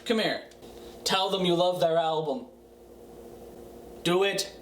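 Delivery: ordinary delivery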